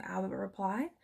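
ordinary delivery

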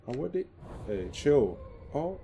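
sudden loud startled cry; startled yelp. Real, not acted. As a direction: jumps and shouts in fright. Startled by the monster.

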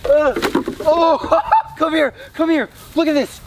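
high astonished oh my gosh; shocked excitement. Real, not acted. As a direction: gasp it out, amazed. Shocked at creature caught.

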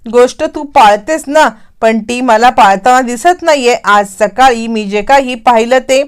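usual speaking voice

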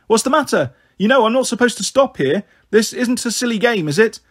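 a stranger stopping her car, half alarmed; worried lady driver. Acted. Stranger lady questions child.

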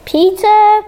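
surprised. Real, not acted. Reacting to game option.

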